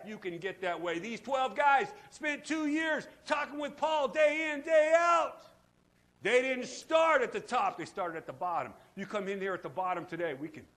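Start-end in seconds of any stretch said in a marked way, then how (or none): 1.0-7.8 loud, slow, stressed; emphatic shout. Real, not acted. Preacher driving point home to congregation, loud and slow. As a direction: hammer it home.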